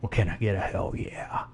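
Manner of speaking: hoarse, whispered ask; raspy whisper. Real, not acted. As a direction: rasp it out under your breath. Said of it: "Got that raspy voice".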